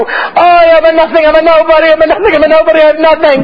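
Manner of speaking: high, mournful cry of humility; chazan's plea. Retold or imitated. Chazan before the ark, crying out humbly. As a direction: plead like the cantor at the ark. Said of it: "he starts to say before HaKodesh".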